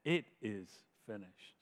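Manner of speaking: soft, low and hushed; a quiet final breath; hushed last words. Retold or imitated. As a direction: let it fall away almost to nothing. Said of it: "and he said".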